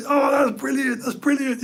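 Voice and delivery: loud, high mimicked glee; hammed-up, shouted impression of a thrilled pal; Steve's high-pitched delight. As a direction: crow with delight like a tickled mate. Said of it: "he was all like that".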